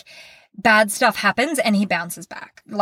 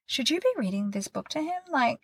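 1 husband's soft doubt; 7 semitones higher, 8 dB quieter than usual. Retold or imitated. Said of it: "my husband is like".

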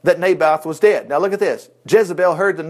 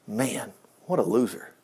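scornful aside; 9 semitones lower, 10 dB quieter than usual. Real, not acted. Preacher's scornful aside on Ahab.

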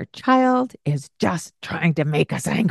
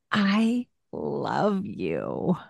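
very slow, softer "I love you"; tender; 4 dB quieter, much slower than usual. Acted. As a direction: let it melt out slowly. Loving words to children.

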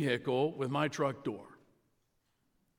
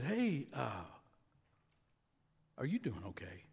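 gentle concern; 3 semitones lower, 8 dB quieter than usual. Retold or imitated. Gentle question to angry man.

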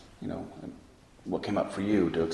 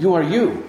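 YOU hit high and loud, then you; insist, leaning hard on the first YOU; emphatic; 8 semitones higher, 7 dB louder than usual. Retold or imitated. Fa Yin answering the question, emphatic and loud.

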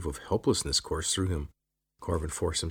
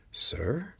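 surprised lizard; 9 semitones higher than usual. A narrator's reading. Lizard shocked.

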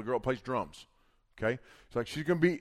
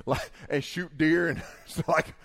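normal talking voice

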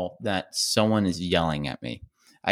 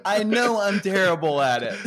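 laughing; 10 semitones higher than usual. Real, not acted. Laughing admission.

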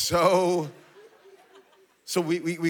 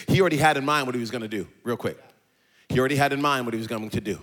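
quick, low rushed aside; quick low recap; fast; 5 semitones lower, 4 dB quieter, much faster than usual. Shown as a demonstration. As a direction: rattle it off quickly. Real quick.

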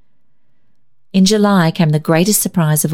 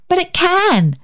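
high, eager cry of protest; a girl's sudden eager outburst; sudden exclamation; 13 semitones higher than usual. A narrator's reading. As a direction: burst out with a bright idea. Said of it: "she exclaimed".

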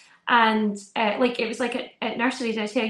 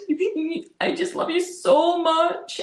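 put-on higher, slow sweet little boy; imitating a pleading child; 6 semitones higher, much slower than usual. Son pleading love to mum, higher slow child voice. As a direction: stretch it out like a kid begging.

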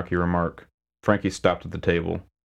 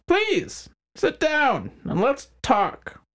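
high, slow mocking greeting; smug crime boss; much slower than usual. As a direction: drawl it out like a gloating mobster. McHines voiced character.

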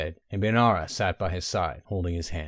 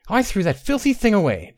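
higher, louder, sharp throwaway line; disgusted retort; 11 semitones higher, 4 dB louder than usual. A narrator's reading. Bainara defending herself to Tay, higher and disgusted. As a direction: spit the words out with disgust.

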